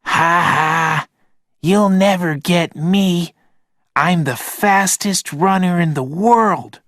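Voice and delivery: put-on high teasing kid voice; laughing child shout. A narrator's reading. Rob running across the playground, high teasing laugh. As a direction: taunt like a boy running away. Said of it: "Rob shouted, laughing".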